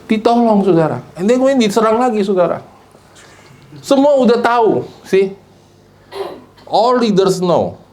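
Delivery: lebih keras, menekan setiap kata; khotbah keras. Acted. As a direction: dorong kata-kata dengan tegas. Preacher builds intensity. (pendeta menegaskan kepada jemaat, keras)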